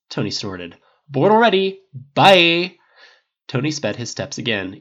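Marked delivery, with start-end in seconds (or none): 1.1-2.8 loud, high, breezy brush-off; dismissive. A narrator's reading. Tony walking off from Harris, dismissive. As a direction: toss out a loud goodbye.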